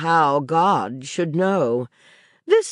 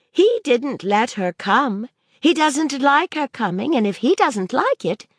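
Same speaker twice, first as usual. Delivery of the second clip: put-on higher, earnest little girl's voice; a young girl working things out aloud; child Maisie; 9 semitones higher than usual. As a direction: speak as the curious child arguing.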